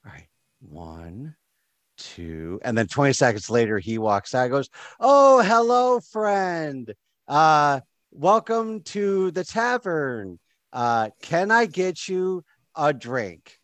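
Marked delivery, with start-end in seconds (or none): 0.1-2.6 very soft, low counting; barely audible. Acted. Barkeep heard from outside counting, barely audible. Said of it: "you hear just barely from inside". 5.0-13.6 high, drawn-out theatrical welcome; over-friendly barkeep. Acted. Tavern keeper greeting a customer, overly friendly.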